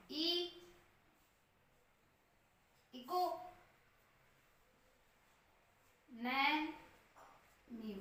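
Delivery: "ई, इको, Naimi" broken into separate slow syllables with long pauses. Shown as a demonstration. Teacher sounding out the word for students, slowly syllable by syllable. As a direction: spell out "Naimi" slowly, one syllable at a time. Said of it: "तो ये हो गया economy".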